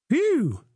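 high, hammed-up phew of relief; exaggerated relief. A narrator's reading. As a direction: blow out a big overdone sigh of relief. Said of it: "the third said in exaggerated relief".

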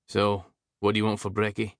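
lightly voiced Scottish man; a hint of a Scottish lilt; Scottish accent. Declan asks, Scottish voice.

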